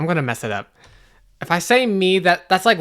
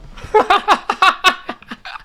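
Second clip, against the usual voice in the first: high staccato evil laugh; villainous cackle; 12 semitones higher than usual. Pretend. Player as evil role mocking Chris, villainous cackle. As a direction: cackle in triumph at your rival.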